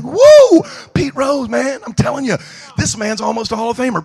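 high, loud whoop of excitement; hammed-up starstruck fan; starstruck shout. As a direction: whoop like a fan spotting a hero. Starstruck at meeting Pete Rose.

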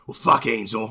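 bad Boston accent, lightly done; a hint of a terrible Boston accent. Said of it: "really terrible Boston accent".

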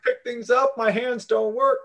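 mock infomercial victim.

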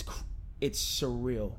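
usual speaking voice

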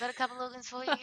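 high, chirpy mock-casual caller; imitating an offhand offer. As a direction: drop big news like small talk. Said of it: "so casual".

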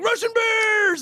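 very high hammed-up rallying shout; mock battle cry. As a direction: scream it like a cartoon rebel hero. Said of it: "Wolverines would be like".

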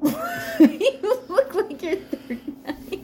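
high, slow, laughing through words. Real, not acted. Laughing while teasing.

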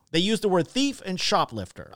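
usual speaking voice

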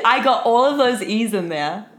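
ordinary talk, nothing marked

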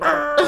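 high mock growl; dog growl. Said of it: "Cedric was like".

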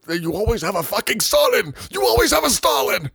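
high, loud rising outburst; exasperated shout. Real, not acted. Ranting to co-host about governments, exasperated shout.

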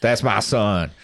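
mock Southern drawl, proud papa; put-on Southern accent. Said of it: "Why do you always go to that accent".

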